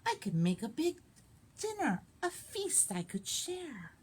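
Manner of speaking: put-on lively read-aloud for children; animated picture-book reading; story narration. A narrator's reading. Librarian reads picture book.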